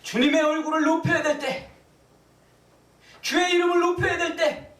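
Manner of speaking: very high, loud proclamation; full-force preaching. Real, not acted. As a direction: cry it out to the congregation. (회중을 향해 높고 크게 외치는 설교)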